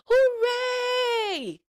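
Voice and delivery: high, loud drawn-out cheer; long celebratory shout; joyful cheer. A narrator's reading. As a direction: whoop it out like a whole crowd. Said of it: "everyone cheered".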